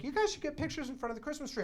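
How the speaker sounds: high, quick motherly suggestion; eager mom. Retold or imitated. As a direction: chirp it quickly like a keen mother. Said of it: "my mom is just like".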